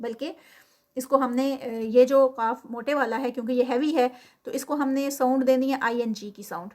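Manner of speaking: normal talking voice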